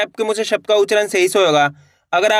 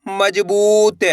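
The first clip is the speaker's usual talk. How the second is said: word stretched very slowly; drawn-out reading; much slower than usual. Shown as a demonstration. Teacher reading a word for learners, drawn out. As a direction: read it out with long held vowels. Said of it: "एक अक्षर का उच्चारण करना है".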